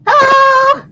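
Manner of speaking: high, playful puppet voice; chirpy little puppet character; What What puppet voice. Acted. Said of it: "This is my little friend".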